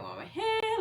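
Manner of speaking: suddenly very high squeak; mock inner panic. Acted inner panic, repeated question.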